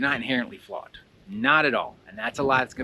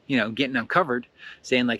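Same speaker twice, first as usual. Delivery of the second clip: ordinary delivery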